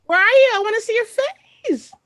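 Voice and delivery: very high, eager squeal; excited calling out. Real, not acted. Host looking for a guest on video call, excited squeal. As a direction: call out high and loud, eager to see them.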